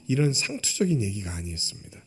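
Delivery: hushed whisper. Real, not acted. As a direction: drop to a whisper for effect. Pointed aside to congregation. (속삭이듯 낮게)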